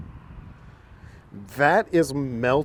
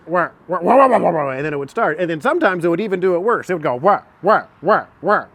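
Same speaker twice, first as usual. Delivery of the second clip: hyperspeed telling; 4 semitones higher than usual. Real, not acted. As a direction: race through the story at top speed. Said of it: "hyperspeed story".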